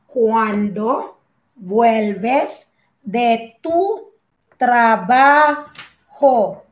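very slow "cuándo vuelves de tu trabajo", paused between words; slow dictation. Shown as a demonstration. Teacher repeating the example for learners, very slowly in pieces. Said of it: "Okay, ejemplo".

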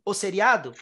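ordinary talk

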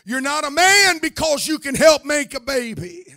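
usual speaking voice

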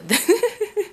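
high giggle; laughing. Real, not acted. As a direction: break into a laugh. Laughing mid-sentence at memory.

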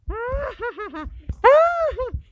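rire maléfique aigu et exagéré; rire de méchant. Acted. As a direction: ricane comme un méchant de dessin animé. Rire de méchant, voix changée.

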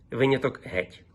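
ordinary talk, nothing marked

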